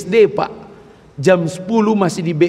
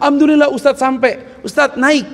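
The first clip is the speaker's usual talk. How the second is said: higher, eager relieved announcement; relieved driver; 8 semitones higher than usual. Retold or imitated. Driver telling the ustadz they've arrived, relieved and high.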